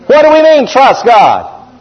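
emphatic repeat. Shown as a demonstration. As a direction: drive the question home. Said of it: "repeated question".

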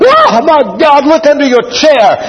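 high, alarmed cry of warning; hammed-up panicked warning, pitched high; mock alarm. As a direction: cry out as if a snake is underfoot.

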